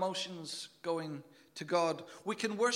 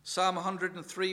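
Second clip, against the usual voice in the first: ordinary talk, nothing marked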